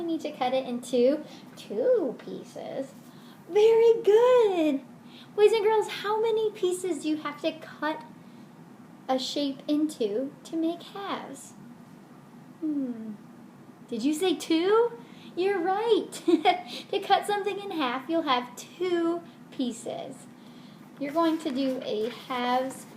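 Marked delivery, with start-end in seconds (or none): 3.5-11.5 put-on beam praise at the kids; kids show host. 13.9-20.1 higher cheerful host voice; bright praise for the kids; kids show host. Acted.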